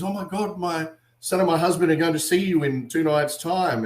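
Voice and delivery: high-pitched starstruck gushing; imitated thrilled mum. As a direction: gush like mum meeting a celebrity. Retelling mum meeting the wrestler.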